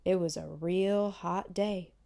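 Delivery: ordinary delivery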